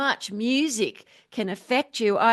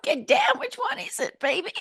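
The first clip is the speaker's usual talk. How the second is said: high, strained funk singer squeal; 11 semitones higher than usual. Retold or imitated. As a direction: yelp it up high like a soul singer. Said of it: "I think [name]".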